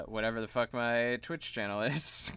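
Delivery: normal talking voice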